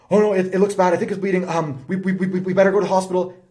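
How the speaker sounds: high, loud alarmed stammer; panicked fear. Retold or imitated. Mom reacts to child's scraped knee, high loud panicky voice. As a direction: sound like a shaken grown-up losing it. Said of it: "And the reaction is".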